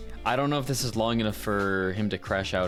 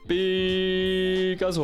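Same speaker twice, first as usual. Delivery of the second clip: high, loud, held-out cry; playful drawn-out call; 10 semitones higher, 5 dB louder, much slower than usual. Pretend. Streamer celebrating their own take, playful drawn-out call. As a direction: belt it out, stretching each vowel. Calls out the name, playfully stretched.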